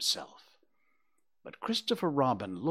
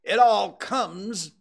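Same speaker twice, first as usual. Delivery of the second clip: slow, loud, high-pitched scolding; sternly drawn out and emphatic; stern rabbit; 9 semitones higher, 8 dB louder, slower than usual. A narrator's reading. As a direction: lay down the law as a stern rabbit. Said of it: "said the rabbit sternly".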